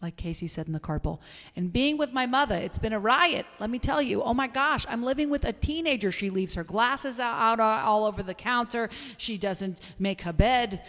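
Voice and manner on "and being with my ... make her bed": higher, quick-talking comic complaint; exasperated mother bit, pitched up and rattled off; Marcy Steinberg. Acted. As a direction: play up the kvetching for laughs. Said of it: "say it in my best Marcy Steinberg voice".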